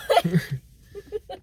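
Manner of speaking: high rising, laughing what; surprised, laughing; caught off guard. Retold or imitated. Friend imitating him caught off guard, laughing surprise. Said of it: "he was like".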